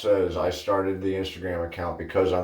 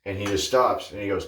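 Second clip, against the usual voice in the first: normal talking voice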